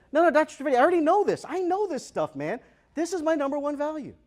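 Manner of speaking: high, loud insistent woman; insistent lady. Retold or imitated. Lady insisting to the speaker, high and loud. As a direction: mimic her pushing back, high and loud.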